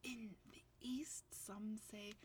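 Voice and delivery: whispered, suspenseful setup; barely voiced lead-in to a reveal; hushed build-up. Real, not acted.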